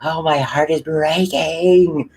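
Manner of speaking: slow, rising hammed-up lament; slow melodramatic mock sob, climbing high; mock wailing. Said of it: "screaming and wailing".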